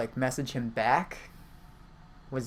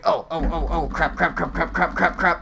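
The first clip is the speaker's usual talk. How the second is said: mock panic; 3 semitones higher, 6 dB louder than usual. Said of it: "so he's like".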